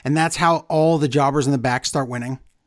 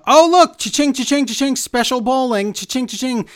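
high, mock-delighted sing-out; hammed-up gleeful money chant, pitched high; Stephanie McMahon; 5 semitones higher than usual. Bowling with the regular folk, high mock delight. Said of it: "I'm Stephanie McMahon".

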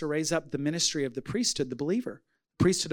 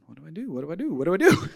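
flustered self-imitation; faster than usual. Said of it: "And I'm going".

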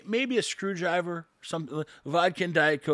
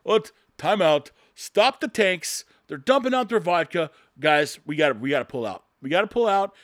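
louder mock commander calling a halt; mock urgent order; 5 dB louder than usual. Imagined Putin halting tanks over dumped vodka, mock urgency. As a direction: call off the troops in pretend alarm. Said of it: "Putin's gonna be like".

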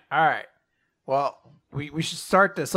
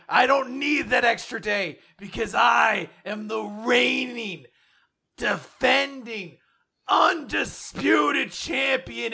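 wrestling promo; 9 semitones higher than usual. Acted. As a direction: shout it like a champion cutting a promo. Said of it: "you just wanna cut promos all the time".

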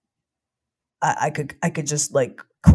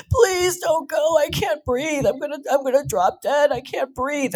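frantic mother's cry, much higher; desperate pleading mom; 13 semitones higher than usual. Retold or imitated. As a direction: beg like a mother gasping for air. Mom chasing, can't breathe.

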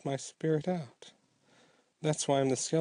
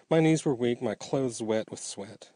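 plain reading voice

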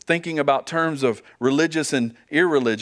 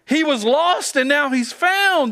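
much higher, slow, delighted "found"; joyful rejoicing; 10 semitones higher, 3 dB louder, slower than usual. Retold or imitated. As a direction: stretch "found" with glad relief. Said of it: "Does he rejoice and say".

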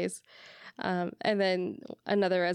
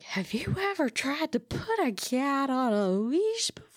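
put-on high, slow drunk whisper; drunk person voice; 10 semitones higher, slower than usual. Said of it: "your drunk person voice".

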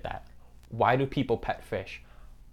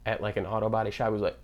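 ordinary delivery

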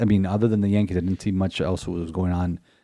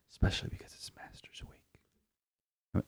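hushed golf announcer whisper; 16 dB quieter than usual. Pretend. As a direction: breathe it like a golf broadcast.

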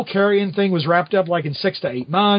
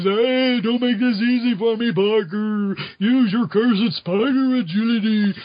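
slow, higher-pitched mock zombie villain; Carrion; 4 semitones higher, slower than usual. Carrion after knocking Peter off the balcony, zombie voice. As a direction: groan out the threat, words stretched. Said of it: "knocks Peter off the balcony and says".

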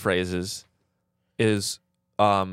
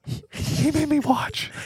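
much higher, slow, haunted wail; traumatized assistant; slower than usual. Pretend. Assistant confessing what Michael Bay made him watch, high slow haunted. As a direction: crack as if reliving horror.

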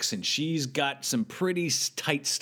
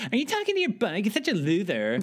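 much higher mock girly voice, mocking; hammed-up mean girl teasing a loser; snooty cool girl; 8 semitones higher than usual. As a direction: sneer it down at him like the popular girl. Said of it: "the pretty girl from homeroom comes up and goes".

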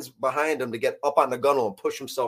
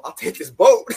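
joking; 9 dB louder than usual. Retold or imitated. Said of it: "You know, I was joking".

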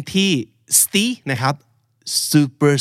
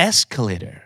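"Escalator" with ES stressed, -tor light; unstressed final syllable; much slower than usual. Shown as a demonstration. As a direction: let the -tor in "Escalator" drop away unstressed. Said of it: "มันแทบจะไม่เคยถูกย้ำเลย".

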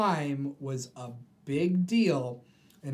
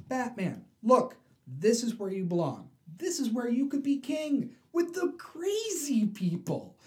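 high, coaxing villain voice; Joker voice; 9 semitones higher, 3 dB louder than usual. Retold or imitated. Joker telling Batman he belongs with the crazies, high and coaxing. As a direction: tempt him like a mad host, pitched up.

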